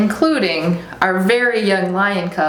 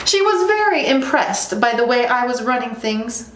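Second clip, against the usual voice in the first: higher, self-satisfied cub; boastful lion cub; 5 semitones higher than usual. Acted. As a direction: brag lightly in a raised voice. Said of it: "said the cub".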